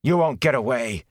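high, venomous angry threat; angry spitting. A narrator's reading. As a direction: spit the threat out at them. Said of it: "he spat angrily".

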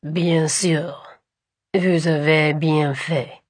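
low, slow flawless French; flawless French accent. Acted. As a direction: speak smooth native French. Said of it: "in a flawless French accent".

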